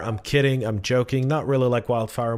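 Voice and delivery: ordinary delivery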